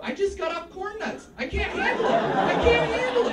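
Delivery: high-pitched comic panic, building; mock desperation. Comic tells cookie guy he's easily addicted, mock desperate.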